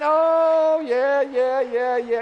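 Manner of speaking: loud, drawn-out mock "oh yeah"; mocking easygoing acceptance. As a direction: blare it out, ridiculing lax grace. Mocks a lax view of grace.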